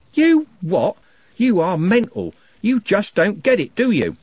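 high, loud furious outburst; in his face, stinging. A narrator's reading. Trev in gang leader's face, furious. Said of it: "like a wasp".

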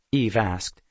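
plain reading voice

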